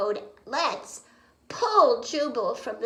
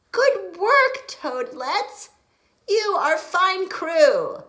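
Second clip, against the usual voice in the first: hearty captain; 8 semitones higher, 8 dB louder, slower than usual. Acted. Captain praising crew.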